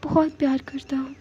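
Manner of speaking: breathy dying whisper. Acted. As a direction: whisper it like your last breath. Dying lover's last words. (मरते हुए फुसफुसाहट)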